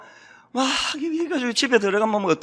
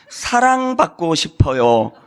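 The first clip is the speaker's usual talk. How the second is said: slow, gentle plea; tender interpretation; 5 semitones lower, slower than usual. Shown as a demonstration. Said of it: "저는 그걸 딱 이렇게 통변해요". (화 뒤에 숨은 마음을 천천히 부드럽게)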